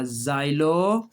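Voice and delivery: xylophone very slow, starting with a z sound; slow pronunciation. Shown as a demonstration. Teacher showing children the z sound, very slowly. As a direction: stretch xylophone out, zai-lo-fone. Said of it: "जे की आवाज़ देगा".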